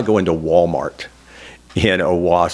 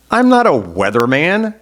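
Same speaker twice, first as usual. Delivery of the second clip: high, slow, baffled protest; startled denial; 8 semitones higher, 4 dB louder than usual. Retold or imitated. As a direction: push back in disbelief, drawn out. Mistaken for TV weatherman.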